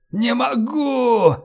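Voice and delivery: high, slow, strained wail; agonised drawn-out cry; wounded, struggling. A narrator's reading. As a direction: drag it out in pain. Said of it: "Роллинсон закашлялся".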